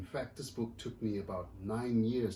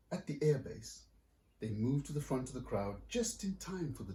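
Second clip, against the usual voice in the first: lively picture-book reading; story narration; 2 semitones higher than usual. A narrator's reading. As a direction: read it like to a room of kids. Author reads picture book aloud.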